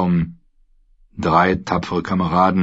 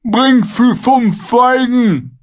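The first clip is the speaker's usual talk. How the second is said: sehr hoch, laut und langsam gedehnt; Ziegenstimme; 6 dB louder, much slower than usual. Acted. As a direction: einen Befehl sabbernd in die Länge ziehen. Vargo Hoth befiehlt. (der Söldnerführer befiehlt seinen Männern, hoch und gedehnt)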